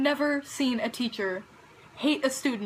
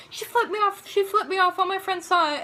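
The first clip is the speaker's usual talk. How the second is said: put-on tattling girl; 7 semitones higher, 4 dB louder than usual. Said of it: "telling her over and over again".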